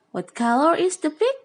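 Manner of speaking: usual speaking voice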